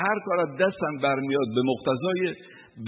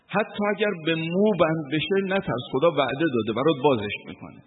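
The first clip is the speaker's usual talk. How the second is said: raised, impassioned sermon voice, slowing on key words; fervent preaching; 4 semitones higher than usual. Acted. Preacher urging the congregation not to fear, fervent sermon voice.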